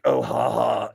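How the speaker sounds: low, loud mock ha-ha; mock laugh. Host voices viewers jeering at him, low mock laugh. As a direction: imitate a jeering crowd with a fake laugh. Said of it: "you guys are like".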